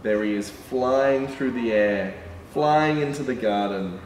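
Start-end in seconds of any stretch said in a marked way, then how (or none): none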